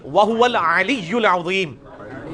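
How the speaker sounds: slow chanted "وَهُوَ الْعَلِيُّ الْعَظِيمُ"; Quranic recitation. Shown as a demonstration. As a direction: recite it like the imam, slowly. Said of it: "یا فرق دے نا،".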